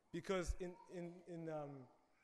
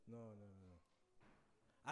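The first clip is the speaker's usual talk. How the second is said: low, slow, soft headshake no; stern disapproval; 8 semitones lower, 12 dB quieter, much slower than usual. Retold or imitated. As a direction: drag out each no, quiet and disapproving. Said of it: "I'm sure it wasn't like".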